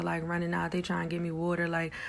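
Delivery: ordinary delivery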